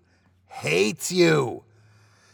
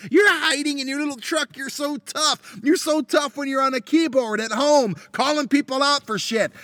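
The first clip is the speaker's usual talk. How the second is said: taunting; 8 semitones higher than usual. Real, not acted. Taunting the tailgater.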